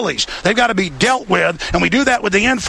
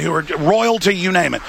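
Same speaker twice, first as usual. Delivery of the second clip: ordinary talk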